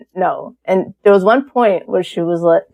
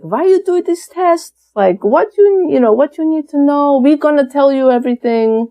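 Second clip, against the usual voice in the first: put-on high Russian-accented mother; retold voicemail in a high Russian accent; imitated mom's accent; 7 semitones higher than usual. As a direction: do a Russian mom questioning her child, pitched up.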